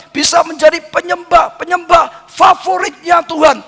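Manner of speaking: lifted, fervent preaching voice; rising preaching. Acted. Pastor urging the congregation to worship, rising fervour. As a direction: build the exhortation with pitch up.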